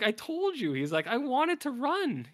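high, loud whiny pleading, slowing down; hammed-up heartbroken whine; betrayed whine. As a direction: whine it like a hurt friend. [name] wails at betrayal.

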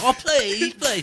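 put-on high, loud whining boy; mock whining son; begging child. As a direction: plead like a kid.

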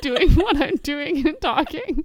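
real laughter breaking in mid-sentence; laughing. Real, not acted. Podcaster laughing while fighting recording software. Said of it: "what is happening?".

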